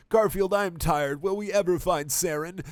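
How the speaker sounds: high, whining complaint; whiny Miranda. Acted. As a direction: whine like a tired squad mate. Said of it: "whined Miranda with moaning".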